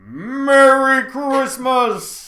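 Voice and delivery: Santa voice. Shown as a demonstration. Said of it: "What else are you gonna say".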